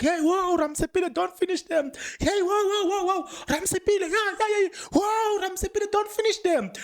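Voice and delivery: playful shouting plea from the gallery; mock pleading shout. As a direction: yell at him to hold back, half joking. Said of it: "I was like".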